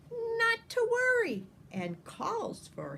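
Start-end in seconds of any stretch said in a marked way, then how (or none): 0.1-1.5 high, bright mock hero voice; cheerful reassurance. A narrator's reading. Finny calming the trapped singers, much higher. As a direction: pipe up to calm everyone.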